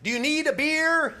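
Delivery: high, slow yelled question across water; shouting from water skis; mock yell from afar. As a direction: call out over a boat engine, drawn out. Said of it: "I thought she yelled".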